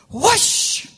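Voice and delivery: high swooping whoosh; storytelling whoosh effect; playful whoosh. Acted.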